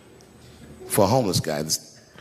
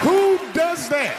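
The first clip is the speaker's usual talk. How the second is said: high, slow incredulous yell; outraged shout; much slower than usual. Real, not acted. To the crowd, slow high outraged shout. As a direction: blast the indignation.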